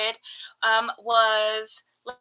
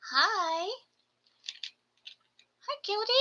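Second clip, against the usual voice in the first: much higher, soft coo; soft high hello to a gecko; 8 semitones higher, 8 dB quieter than usual. Real, not acted. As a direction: greet a tiny pet tenderly. Greeting the gecko.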